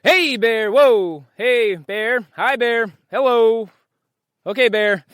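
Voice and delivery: high, loud calm calling to a bear; scaring off a bear. Shown as a demonstration. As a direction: call out to the bear, big but friendly. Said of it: "Do it".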